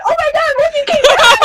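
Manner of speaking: high, loud, laughing squeal; excited idol squeal. Retold or imitated. Idols thrilled the fan came back, high laughing squeal. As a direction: shriek it with a laugh.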